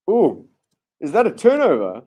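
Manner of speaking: sudden high, loud gasp of hope; momentarily excited. Real, not acted. Commentator briefly thrilled for the Eagles, excited. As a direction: jump up at a possible turnover. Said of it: "I was momentarily excited for the Eagles".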